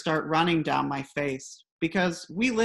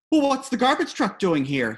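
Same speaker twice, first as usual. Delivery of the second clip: agitated question; 6 semitones higher, 5 dB louder than usual. Retold or imitated. Father anxious about early truck.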